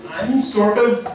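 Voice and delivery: a hint of an Indian accent, slowed and stretched. Comic answering his own age question with a light Indian accent. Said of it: "three words that Indians love to use".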